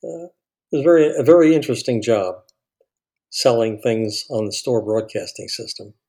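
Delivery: ordinary talk, nothing marked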